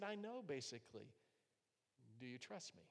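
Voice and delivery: soft, fading to a near whisper; gentle voice of God. Retold or imitated. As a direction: speak tenderly, as God reassuring. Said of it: "And he said".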